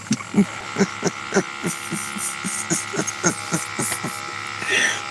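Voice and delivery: breathless silent laugh; long wheezing laugh. Real, not acted. Laughing at the clip.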